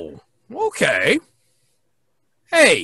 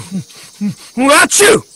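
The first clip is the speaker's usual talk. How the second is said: loud sneeze; 4 semitones higher, 7 dB louder than usual. Acted. Pirate sneezing fit.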